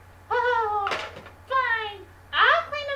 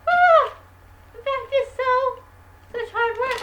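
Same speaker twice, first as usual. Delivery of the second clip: exaggerated tired sigh; 7 dB louder than usual. [name] hams up exhaustion.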